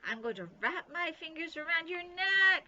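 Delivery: high, loud spooky howl; spooky ghost howl. A narrator's reading. Bean keeps haunting to scare her sister Nancy, spooky howl. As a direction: moan the threat like a phantom. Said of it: "she howled in a spooky voice".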